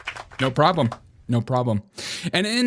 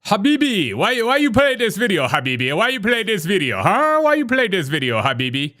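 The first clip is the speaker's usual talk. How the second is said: put-on high, pleading Arabic-accented prince; Arabic-accented prince, higher than usual; 6 semitones higher than usual. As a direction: whine it like a Gulf prince. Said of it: "It's the prince".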